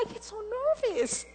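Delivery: high, anxious plea; nervous. Retold or imitated. Telling her friend she is too nervous, high and anxious. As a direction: squeak it out like a scared kid. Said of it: "And I said".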